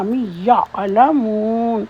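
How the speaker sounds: مین یعلمون slowly, the "n" melted into "ya"; nasal merge. Shown as a demonstration. Teacher demonstrating ikhfa on من يعلمون, slow. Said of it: "مین یہاں پہ اخوا کیا جائے گا".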